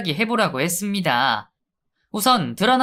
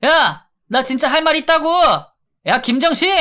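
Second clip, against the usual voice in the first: much higher, louder shouting from a cell; yelling; 9 semitones higher, 5 dB louder than usual. Acted. Locked-up inmate bellowing after the guard, loud. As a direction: bellow his name through the door. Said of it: "고래고래 소리를 지르더라고요".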